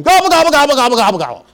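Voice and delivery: loud, high gobbles tumbling downward; imitated gobbling yell. Daniel yelling back from inside Walmart, high loud gobbling. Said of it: "he yells back at him".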